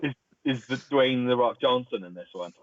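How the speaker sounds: normal talking voice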